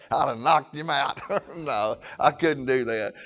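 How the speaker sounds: amused, chuckling admission. Real, not acted. Preacher admitting he couldn't take being spat on, chuckling. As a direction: laugh through the words, low and soft. Laughing over his own reaction.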